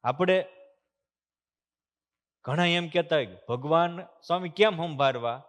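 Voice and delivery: usual speaking voice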